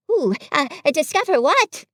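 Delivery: high, hesitant little question; anxious. A narrator's reading. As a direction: stammer it out nervously, rising in pitch. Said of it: "said Piglet anxiously".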